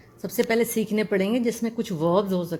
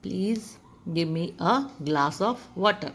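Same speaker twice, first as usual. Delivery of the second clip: Please give me a glass of water, very slow and clear; slow example sentence; much slower than usual. Shown as a demonstration. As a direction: read the example slowly for learners. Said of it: "please का इस्तेमाल कर सकते हैं". (धीरे धीरे उदाहरण वाक्य)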